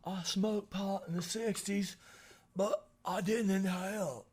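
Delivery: mock statesman's careful confession; Bill Clinton. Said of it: "You just went from Bill Clinton to".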